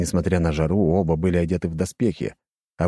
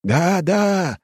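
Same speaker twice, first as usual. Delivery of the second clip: excited; 13 semitones higher, 3 dB louder than usual. Acted. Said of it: "возбуждённо повторил другой".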